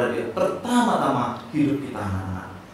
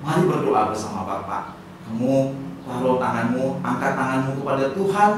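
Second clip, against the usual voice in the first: ordinary talk, nothing marked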